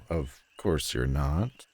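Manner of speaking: ordinary talk, nothing marked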